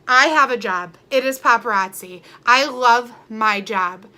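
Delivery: ordinary talk, nothing marked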